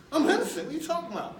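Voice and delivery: retold indignant protest to his lawyer. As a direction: protest your innocence in disbelief.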